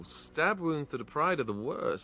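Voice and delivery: mock wounded. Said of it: "Poor baby".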